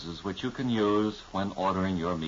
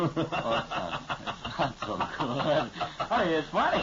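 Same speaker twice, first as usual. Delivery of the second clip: giggling through the words; trying not to laugh; 7 semitones higher than usual. Real, not acted. Breaking up over script.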